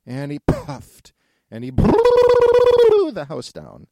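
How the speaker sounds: high, loud, slow blown wail; long blowing howl. A narrator's reading. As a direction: stretch it into a huge blow. Narrator acts big blow.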